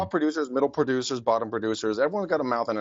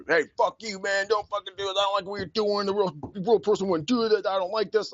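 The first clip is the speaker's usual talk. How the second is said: higher, mocking tough-guy voice; 8 semitones higher than usual. Mimicking the threatening caller to his cohost, mock tough guy. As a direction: ham up the angry caller, pitched high. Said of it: "he was like".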